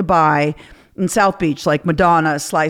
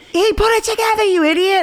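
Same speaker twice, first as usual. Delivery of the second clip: mocking friends; 14 semitones higher than usual. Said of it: "They're like".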